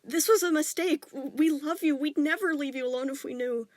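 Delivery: loud, high earnest plea; pleading reassurance. A narrator's reading. Father assuring his son they love him, loud and earnest. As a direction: insist on your love.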